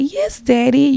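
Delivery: put-on high, girlish "yes daddy"; little girl voice. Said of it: "he wants you to disguise your voice as a little girl".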